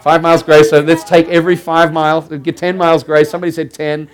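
mock auctioneer. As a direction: call out bids like a mock auctioneer. Mock auction bidding for grace.

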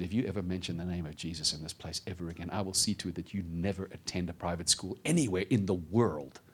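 low, quiet, controlled threat; imitated master warning a pupil softly; menacing hostel master. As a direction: threaten with hushed authority. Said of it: "governmental wolf bares his teeth".

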